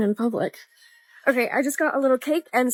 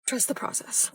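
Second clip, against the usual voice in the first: whisper; 12 dB quieter than usual. Real, not acted. Whispering to herself.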